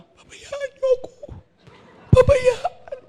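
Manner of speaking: high wailing mock sulk; whiny sister. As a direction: squeal it like a sulking sister. Grieving sister refuses food. (mataas na palahaw na pagmamaktol)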